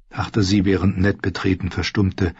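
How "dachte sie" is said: plain reading voice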